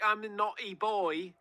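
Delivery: put-on high, drawn-out naughty-boy voice; coy, slow mock confession; coy impression. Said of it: "Say, like".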